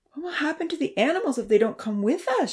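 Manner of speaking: high, sudden worried outburst; girl blurting a worried question; blurted out. A narrator's reading. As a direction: blurt it out anxiously. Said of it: "she blurted out".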